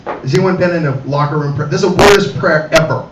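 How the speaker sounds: usual speaking voice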